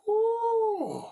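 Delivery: high, loud exhale of relief; whew, glad that's done; relieved whew. Real, not acted. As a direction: blow out the tension at the end. Relief after finishing recording.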